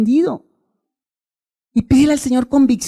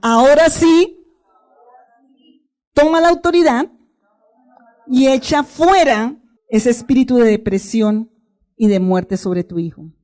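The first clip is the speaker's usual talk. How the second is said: commanding shout; slower than usual. Shown as a demonstration. Pastor has the congregation repeat God's command, shouting.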